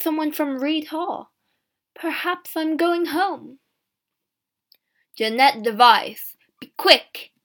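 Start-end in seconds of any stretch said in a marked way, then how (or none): 5.1-7.3 louder call, name drawn out slowly; loud impatient call. Acted. Guard calling the prisoner again, impatient and loud. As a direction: hurry her up at full volume. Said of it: "the guards called again".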